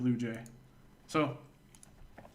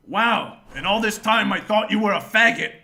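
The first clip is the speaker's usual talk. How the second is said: loud, raised retold amazement; mock astonishment; 7 semitones higher, 9 dB louder than usual. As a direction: quote a friend's big surprise, hammed up. Retold drunk friend's astonished outburst.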